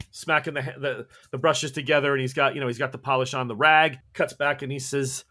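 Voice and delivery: normal talking voice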